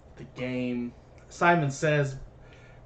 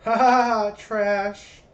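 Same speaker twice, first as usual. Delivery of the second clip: mock laugh; 7 semitones higher, 7 dB louder, much slower than usual. Mocking a character.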